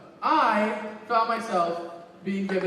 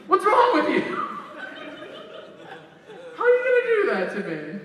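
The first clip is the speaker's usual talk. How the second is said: mock outrage; 10 semitones higher, faster than usual. Continues outburst at Rex, laughing.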